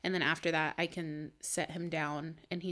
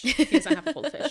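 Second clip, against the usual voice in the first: higher and much louder, laughing through it; laughing aside; 8 semitones higher, 12 dB louder than usual. Real, not acted. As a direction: crack up at your own joke. Laughs at her own goldfish joke.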